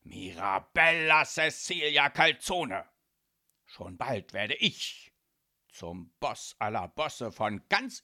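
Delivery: grandiose mafia boss proclamation; pompous father announcing his triumph to his daughter; loud calling out. A narrator's reading. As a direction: declaim it loudly with weighty pauses. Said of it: "fuchtelte wild mit den Armen umher und rief".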